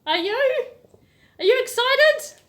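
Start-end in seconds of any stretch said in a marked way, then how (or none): 1.4-2.4 squealed high and loud question; excited. Real, not acted. Teacher hyping up her young viewers, excited. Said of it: "I'm excited".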